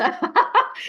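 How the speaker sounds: a high, breathy burst of laughter; high airy laugh. Real, not acted. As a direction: laugh out loud, high and airless. Bursts out laughing after the mock quote.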